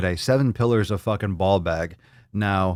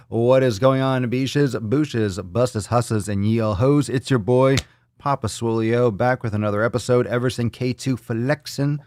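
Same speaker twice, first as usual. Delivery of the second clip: ordinary talk, nothing marked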